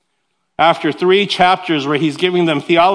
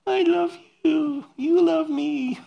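high, slow, teary lovey-dovey voice; hammed-up tearful newlyweds gazing at each other; mock sappy wedding vows; 10 semitones higher, 6 dB quieter, slower than usual. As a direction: swoon it like a sentimental bride and groom.

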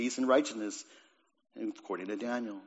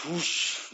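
drawn-out, airy whoosh; whoosh of fire; 4 semitones higher than usual. Retold or imitated. Preacher retelling Elijah defeating Baal's prophets, imitated fiery whoosh. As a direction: imitate flames sweeping through.

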